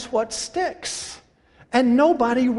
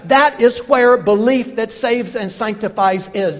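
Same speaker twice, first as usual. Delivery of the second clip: louder, ringing pulpit delivery; full-force preaching; 5 dB louder than usual. Acted. Preacher proclaiming Jesus's words to the congregation, full-force preaching. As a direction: preach it out to the back row.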